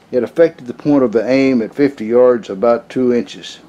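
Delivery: ordinary talk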